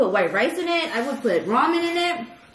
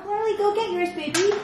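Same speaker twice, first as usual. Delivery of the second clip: high, sweet talk to a pet; dog talk; 10 semitones higher than usual. Real, not acted. Talking to the dog.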